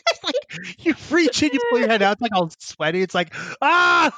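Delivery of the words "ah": disgusted yelp. Retold or imitated. Sweaty suit reaction.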